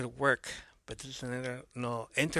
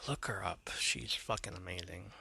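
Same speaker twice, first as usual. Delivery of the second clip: whispering; 4 semitones lower, 9 dB quieter than usual. Real, not acted. Aside urging viewers to look.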